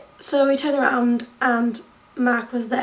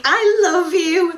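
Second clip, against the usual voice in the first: slow gushing declaration; 4 semitones higher, 4 dB louder, much slower than usual. Retold or imitated. Fan telling drummer her love.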